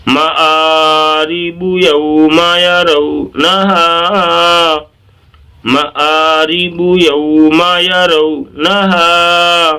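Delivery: slow chant linking معارب into یوم یرونہا; joined-up recitation. Shown as a demonstration. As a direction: sustain each long vowel through معارب یوم یرونہا. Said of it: "ہم اسے جوڑیں گے".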